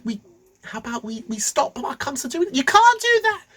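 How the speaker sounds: very high, loud, frantic bickering; squabbling priests. Acted. Priests arguing over the blemished cow, high and frantic. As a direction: argue in panicky squeaky voices. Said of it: "they got into a bit of an argument".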